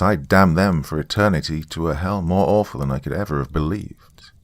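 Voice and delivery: usual speaking voice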